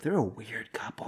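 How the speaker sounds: hushed, conspiratorial aside; a pretend nurse whispering behind the patients' backs; gossipy whisper.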